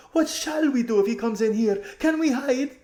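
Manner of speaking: very high, loud panicky voice; a frightened rich girl near tears; terrified girl. Acted. Terrified Lasaraline.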